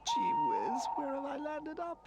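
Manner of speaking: high, tearful lost little voice; lost and frightened, near tears; sobbing toy. A narrator's reading. Lost toy crying alone in a strange place, tearful. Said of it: "he sobbed".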